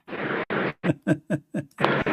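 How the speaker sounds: breathy mocking laugh; a scornful laugh at the caller. Real, not acted. Host laughing at a caller's claim, mocking.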